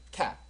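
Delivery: quick, isolated cap with a stopped ending; short vowel. Shown as a demonstration. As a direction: cap said as one short, cut-off syllable. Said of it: "짧게 발음하니까".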